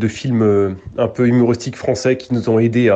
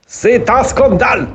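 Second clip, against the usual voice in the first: higher, emphatic mock German accent; 9 semitones higher than usual. Shown as a demonstration. Coach repeats the sample line for the learner, exaggerated German accent. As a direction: exclaim it with a comic German accent. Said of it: "faire l'accent allemand".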